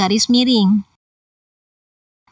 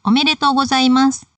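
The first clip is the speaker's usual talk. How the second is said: "Omedetou gozaimasu" as one unit on a raised pitch; whole-phrase intonation; 4 semitones higher than usual. Shown as a demonstration. Teacher demonstrates congratulating someone, one intonation over the whole phrase. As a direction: carry one higher tone right through "Omedetou gozaimasu". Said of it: "diucapkan sebagai satu kesatuan dengan intonasi".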